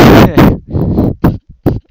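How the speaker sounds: loud helpless laughing fit; burst of laughter. Real, not acted. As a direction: crack up laughing. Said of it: "don't know why I'm laughing".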